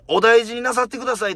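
sweet service voice wishing a patient well; polite receptionist. Retold or imitated. Imitating a clinic receptionist seeing a patient off, high polite voice. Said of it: "丁寧に声をかけてくれる店員".

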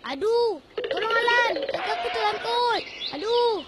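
high, distressed wail; pained cry for help. Acted. Trapped boy begs friend for help, crying out in pain. Foot caught in a snare.

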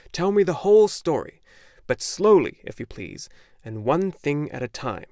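put-on old bookseller. A narrator's reading. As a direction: insist the boy stay.

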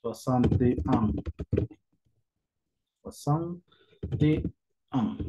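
"Soixante et un" lento con pausas; slow demo pronunciation. Shown as a demonstration. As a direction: separa soixante, et y un. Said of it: "Como decimos sesenta y uno". (despacio, palabra por palabra)

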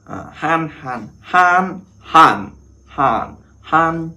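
teacher sounding out "han, han" up high; drilling Hán. Shown as a demonstration. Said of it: "Hán".

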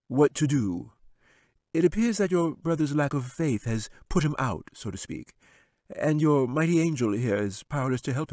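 high, sly wizard voice. Acted. Wizard dismissing the angel, sly and high. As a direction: sneer it down from the throne.

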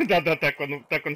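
loud laughing gloat. Real, not acted. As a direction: laugh through the words. Enemy trips, gamer laughs.

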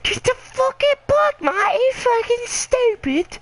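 screaming high in frustration at a teammate; furious high yell. Real, not acted. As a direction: yell it high and raw, fed up. Raging at his team mate.

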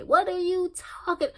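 high squeaky mock outrage; jokingly outraged at her own complaints; mock squeal. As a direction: squeal it in disbelief at yourself. Self-talk, mock outrage.

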